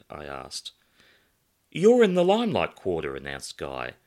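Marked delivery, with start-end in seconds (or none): 1.7-3.1 loud, high announcer-like delivery; grand announcement. Acted.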